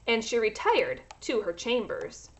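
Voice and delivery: ordinary delivery, nothing marked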